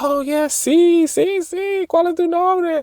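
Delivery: very high, slow, thrilled squeal; delighted high-pitched girl voice; excited girl. Retold or imitated. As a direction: gush like she just met a Spanish speaker. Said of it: "she got all excited".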